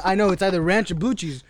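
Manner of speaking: ordinary delivery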